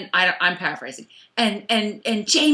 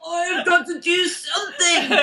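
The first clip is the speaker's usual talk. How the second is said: higher-pitched, hammed-up panicky wail; Ian; 5 semitones higher than usual. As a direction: mock-desperate whine, laughing through it. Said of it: "Ian's sitting there all".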